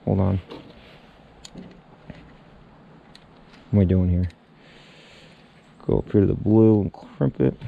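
muffled by something in mouth; mouth full. Real, not acted. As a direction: talk with a connector between lips. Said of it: "So now that that's out, I can talk like a human being".

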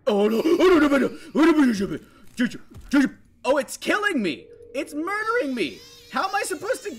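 high, loud laughing panic; panicked laughing screams. Real, not acted. Player caught and killed by monster, laughing panic. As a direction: scream and laugh as it gets you.

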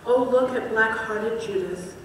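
normal talking voice

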